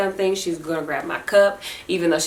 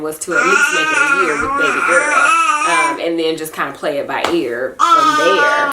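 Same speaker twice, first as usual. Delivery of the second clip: louder, tearful, voice breaking; crying while talking; 7 semitones higher, 4 dB louder than usual. Real, not acted. Mother to viewers, crying over her nursing plan. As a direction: push through the tears.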